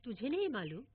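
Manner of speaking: ordinary delivery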